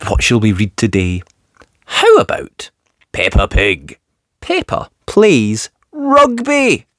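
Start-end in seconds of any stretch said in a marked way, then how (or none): none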